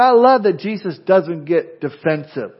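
usual speaking voice

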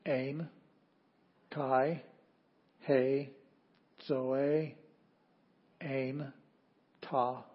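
Greek read out word by word; deliberate, isolated words of a Greek reading; reading aloud. Shown as a demonstration. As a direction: leave a long pause after every word. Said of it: "we'll start reading again".

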